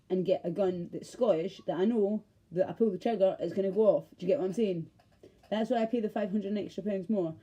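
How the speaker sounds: normal talking voice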